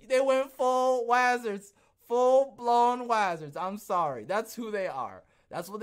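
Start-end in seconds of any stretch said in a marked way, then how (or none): 0.1-3.6 slow, high and loud, each word drawn out; incredulous outcry. Real, not acted. Ranting to viewers about the company, slow, loud and high. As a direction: stretch it out in disbelief.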